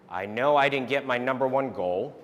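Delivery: ordinary delivery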